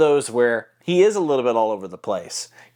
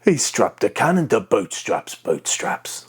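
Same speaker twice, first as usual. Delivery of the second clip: throaty pirate character. Shown as a demonstration. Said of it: "when you combine them both together".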